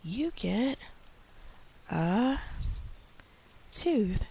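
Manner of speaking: slow, rising sing-song promise; teacher voice for little kids. Shown as a demonstration. Teacher promising a small child a reward, rising sing-song. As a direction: coax a small child, climbing high. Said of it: "for the younger kids".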